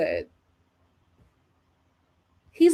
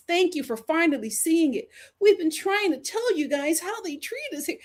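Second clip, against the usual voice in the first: mocking commenters; 6 semitones higher than usual. Mocks commenters thanking the man.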